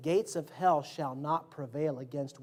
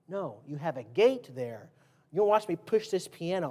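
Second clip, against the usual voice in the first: ordinary talk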